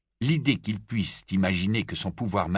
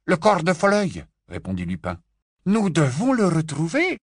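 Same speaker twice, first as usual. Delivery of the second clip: raised, earnest Lupin voice; Lupin's voice; 8 semitones higher, 4 dB louder than usual. A narrator's reading. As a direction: Lupin laying out a job to Bill. Lupin answers.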